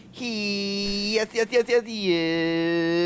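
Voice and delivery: high, building mock moan of yeses; excited porn moan.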